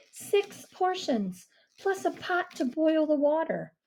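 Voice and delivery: put-on girl voice, calm. Mia voiced.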